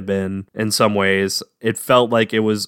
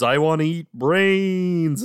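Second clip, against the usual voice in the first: zombie voice; 6 semitones higher, slower than usual. Retold or imitated. Imitating the movie's zombie catchphrase.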